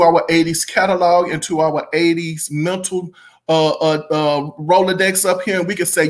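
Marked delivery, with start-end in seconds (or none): none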